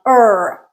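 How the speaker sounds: long er, tense and R-colored; American er sound. Shown as a demonstration. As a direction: hold er with a full R. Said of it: "it's that sound".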